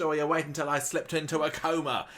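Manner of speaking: high, loud mock outrage; hammed-up fury at the scheming carer; mock outraged old man, pitched high; furious Ken. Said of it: "And he's like".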